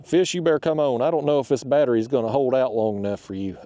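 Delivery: usual speaking voice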